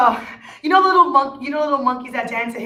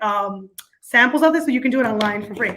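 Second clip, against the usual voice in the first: ordinary talk, nothing marked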